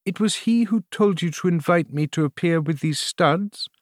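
high-pitched, urgent questioning; anxious queen. A narrator's reading. Queen learning of the cardinal's part, anxious.

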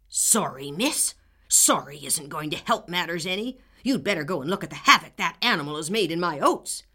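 loud scornful farmer; angry old man. Acted. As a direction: scoff and shout back. Neighbor rejects apology angrily.

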